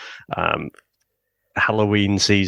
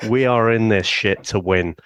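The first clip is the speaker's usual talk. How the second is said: usual speaking voice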